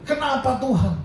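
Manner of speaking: mock-angry whine at God; angry complaint. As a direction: play Jonah huffily complaining to God. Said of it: "marah dia, bilang".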